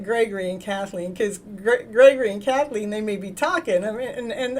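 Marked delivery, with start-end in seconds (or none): none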